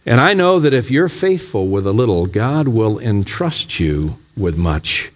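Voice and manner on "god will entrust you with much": normal talking voice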